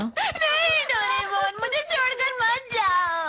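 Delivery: high, drawn-out crying wail; tearful pleading. Acted. Boy begs his friend not to leave him, tearful wail.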